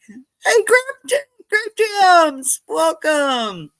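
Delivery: loud, very high call; high-pitched gleeful greeting; excited welcome. Real, not acted. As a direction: whoop a welcome to a new arrival. Calling out to viewer just arrived.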